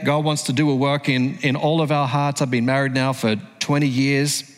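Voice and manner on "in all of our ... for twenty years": normal talking voice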